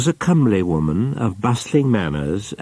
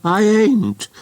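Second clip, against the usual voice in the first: put-on much higher, indignant old aunt's squeak; indignant spinster aunt; 3 dB louder than usual. A narrator's reading. Aunt indignantly denying her age to her brother, high and offended. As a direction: bristle with offended pride, up high. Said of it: "her indignation getting the better".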